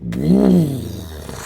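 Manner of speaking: mouth-made engine rev dying away; imitated loud exhaust pipe. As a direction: imitate a loud tailpipe passing. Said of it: "like this, right?".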